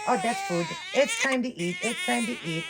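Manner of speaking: put-on high little baby voice, eager; voicing the baby. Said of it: "right away you think".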